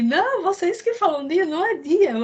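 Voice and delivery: playfully put-on voice of an objecting husband; imitating husband. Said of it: "Aí ele".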